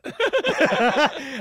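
high silly giggle; imitated laugh. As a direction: keep giggling.

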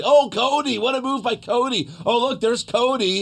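high, excited fanboy announcer; mocking a cheesy announcer's praise; mock commentator gushing. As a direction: gush over Cody like a hyped commentator. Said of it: "what is he, five?".

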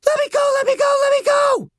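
very high, repeated shriek; frantic screaming plea. Acted. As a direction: scream to be released as you struggle. Girl thrashes against her restraints.

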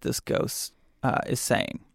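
hushed whisper on ghost; spooky whisper. Acted. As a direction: breathe it softly.

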